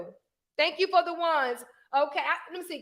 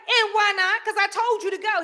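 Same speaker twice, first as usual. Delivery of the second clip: higher, insistent comeback; Jesus questioning; 5 semitones higher than usual. Retold or imitated. God answering a reluctant Moses, mildly insistent. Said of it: "Jesus like".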